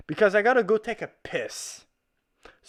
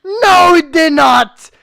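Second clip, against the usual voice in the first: angry shout; 7 semitones higher, 12 dB louder, slower than usual. Real, not acted. Arguing loudly with the documentary.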